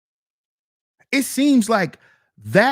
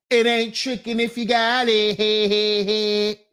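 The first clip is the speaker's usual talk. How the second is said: slow, put-on boastful voice; mock swagger; slower than usual. Streamer quoting the rich guys' boast in a slow put-on voice. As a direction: drawl it like a rich braggart.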